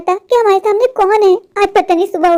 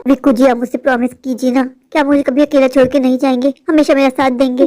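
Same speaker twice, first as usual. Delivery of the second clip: lowered, heavier voice while pleading; suddenly heavy voice; 6 semitones lower than usual. Acted. Julie begging Vicky for a promise, voice turned heavy. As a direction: let the sweet voice drop deep. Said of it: "अचानक से आपकी आवाज़ भारी कैसे हो गई".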